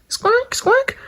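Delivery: high, shrill-pitched parrot squawks; bird squawk. Acted. Toy bird squawking for attention, high squawk.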